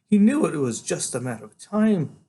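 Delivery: doctor explaining earnestly, voice lifted; sombre woman doctor. A narrator's reading.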